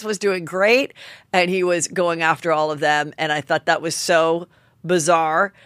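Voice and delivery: ordinary delivery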